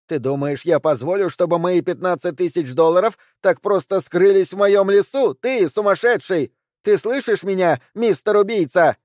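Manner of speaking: high, loud, shrieking taunt; furious yelling. A narrator's reading. Woman screams at the hidden man in the woods. She yells into the forest.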